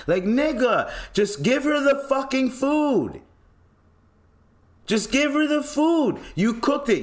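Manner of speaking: high, exasperated yelling; fed-up cry at the chefs; exasperated outburst. Real, not acted.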